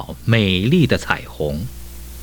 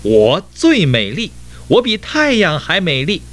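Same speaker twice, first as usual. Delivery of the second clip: high, insistent storybook boast-shout; fiercer yelling; 10 semitones higher than usual. A narrator's reading. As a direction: yell it angrily, pitched right up. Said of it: "喊叫得更凶". (更凶地高声喊叫)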